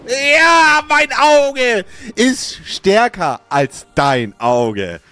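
slow, drawn-out gloating taunt. Acted. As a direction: rub it in slowly.

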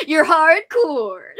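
loud, drawn-out teasing cheer; teasing emphasis. Real, not acted. Teasing repeat, hammed up.

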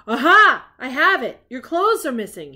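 loud triumphant detective announcement; triumphant cry. A narrator's reading. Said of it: "he cried".

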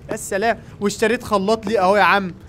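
normal talking voice

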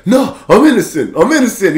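high-pitched mock plea; protesting innocent man. Man getting dragged away by police, mock desperate protest. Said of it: "he's getting dragged away".

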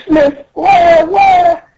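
very high tiny-princess wail; hammed-up sobby whine; mock crying. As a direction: bawl and plead in a squeaky cartoon voice. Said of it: "And then still crying".

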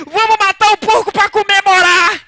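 high voice breaking into laughter; a farmer's punchline, half laughed; laughing through the line. Retold or imitated. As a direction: get the punchline out while cracking up.

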